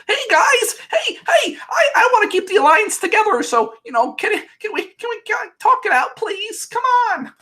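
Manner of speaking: high hammed-up plea to talk it out; mock eager peacemaker. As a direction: beg the alliance to stay together in a squeaky voice. Annalise voiced, pleading alliance.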